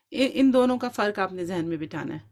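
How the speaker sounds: ordinary talk, nothing marked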